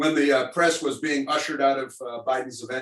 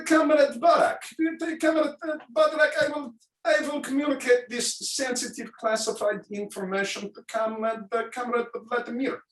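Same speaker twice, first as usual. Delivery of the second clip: high, put-on Russian accent; mock Russian accent; 10 semitones higher than usual. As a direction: play a hammy Soviet official. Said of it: "we do Russians".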